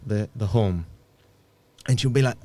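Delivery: normal talking voice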